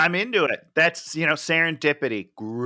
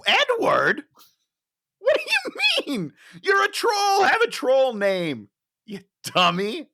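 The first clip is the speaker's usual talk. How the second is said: incredulous shout; 11 semitones higher than usual. Retold or imitated. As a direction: yell it in disbelief at the screen. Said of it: "I was like".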